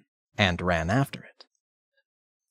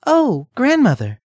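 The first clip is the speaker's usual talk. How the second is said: put-on girl voice; 6 dB louder than usual. Redcap sees the wolf.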